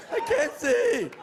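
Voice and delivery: slow, high, loud whine; mock panic yell. Comic redoing the blind man's cry, slow high mock yell. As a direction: stretch it out like a blinded fool.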